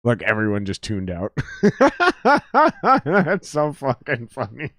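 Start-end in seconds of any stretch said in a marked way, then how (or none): 1.4-3.0 high burst of laughter; real amused cackle. Real, not acted. Amused at everyone tuning out. 3.0-4.8 slow words through real laughter; amused, drawn out between laughs; laughing. Real, not acted. Laughing at own remark.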